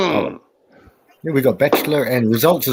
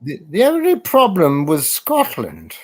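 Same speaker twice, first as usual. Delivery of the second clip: quoting the king; 8 semitones higher than usual. Retold or imitated. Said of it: "the scene from Braveheart, the king".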